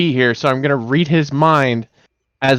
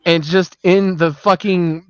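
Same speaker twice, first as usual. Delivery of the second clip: ordinary talk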